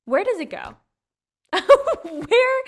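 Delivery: high loud laughing question; squealed laughing where. Real, not acted. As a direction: laugh in disbelief.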